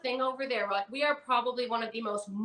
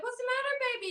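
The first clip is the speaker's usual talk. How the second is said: tender mom voice; much faster than usual. Retold or imitated. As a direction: coo to her sad son like a doting mom. Mom comforting sad son.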